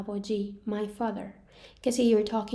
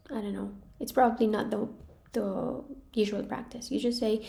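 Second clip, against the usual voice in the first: ordinary talk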